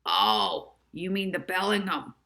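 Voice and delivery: a hint of the gruff driver recognising it; driver's voice. A narrator's reading. Driver guesses the hotel, lightly voiced.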